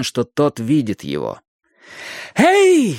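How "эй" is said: loud, high-pitched hail; shout. A narrator's reading. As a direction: call out at full voice to someone far below. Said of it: "закричал он". (громкий высокий окрик)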